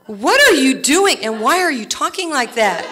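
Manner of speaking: hammed-up scolding mom; mock mother scolding. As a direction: play the baffled mother, half laughing. Mother scolding retold kid.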